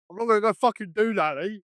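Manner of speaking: high, loud cocky mimicry of a mate; mock defiant refusal. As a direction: throw it back like a stroppy teen. Mimicking friend refusing teacher.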